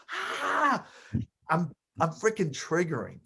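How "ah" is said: a high, mocking "Ah!" laugh right in the face; imitated mocking laugh. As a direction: cackle at him like Alpo did. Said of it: "laughs like this far from my face".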